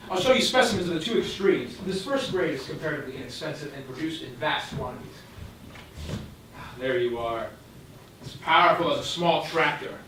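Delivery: normal talking voice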